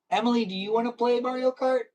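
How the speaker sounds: high, drawn-level singsong ask; high-pitched question. Pretend. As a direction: pitch it high, asking a friend to play. Calls out playful question to Emily.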